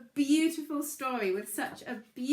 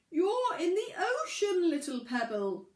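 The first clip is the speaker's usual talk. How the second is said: booming whale voice; 6 semitones higher, 4 dB louder, slower than usual. A narrator's reading. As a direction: boom it up from the depths, slowly. Whale character speaks.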